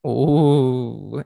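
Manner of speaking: ordinary delivery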